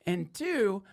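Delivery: normal talking voice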